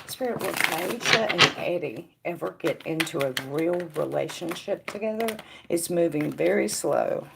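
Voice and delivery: soft, hushed asking of the spirits; quiet question to Spirit. Acted. Psychic quietly asking Spirit about a viewer's studies, hushed. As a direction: murmur the question inward, as if to Spirit.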